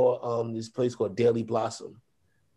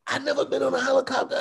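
high, quick imitation of a thrilled wife; mock amazed; 13 semitones higher, faster than usual. Wife facing her first helicopter ride, mock amazed. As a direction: blurt it out as the astonished wife.

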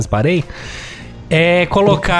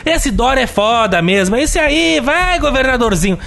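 mocking angry commenter; 8 semitones higher, slower than usual.

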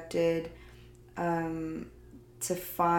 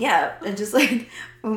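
voice cracking; 4 semitones higher, 8 dB louder than usual. Real, not acted. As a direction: voice breaking upward mid-laugh. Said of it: "my voice just cracked".

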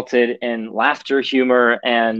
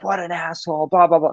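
retelling a friend's rant at a driver, higher; a friend fuming at a bad driver, replayed lightly; mock swearing; 5 semitones higher than usual. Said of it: "starts swearing at the guy".

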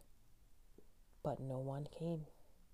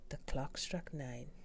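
usual speaking voice

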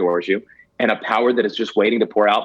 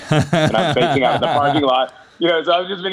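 laughing; 4 semitones higher than usual. Real, not acted. Laughing at own embarrassment.

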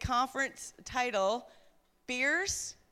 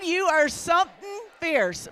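calling out; 4 semitones higher, 6 dB louder than usual. Real, not acted. Calling out women by name.

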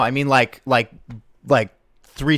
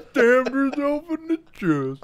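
higher, slow theatrical lament; dramatic tragedian; 12 semitones higher, slower than usual. Acted. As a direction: declaim it like a melodramatic actor. Hamming Shakespeare tragedy.

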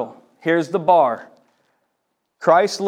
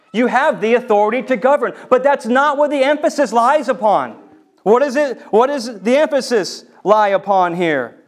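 high, raised preaching voice; insistent, ringing sermon delivery; forceful preaching; 7 semitones higher, 8 dB louder than usual. Real, not acted. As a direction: press the point hard on the congregation.

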